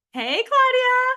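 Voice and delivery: cheerful shout-out to a colleague; excited call-out. Real, not acted. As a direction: call her name with delight. Host spots colleague, calls out.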